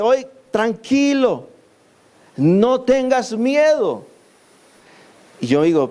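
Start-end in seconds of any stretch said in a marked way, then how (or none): 0.0-4.0 high, slow, soothing prophet voice; slowly and higher, calming a frightened servant; calm reassurance. Acted.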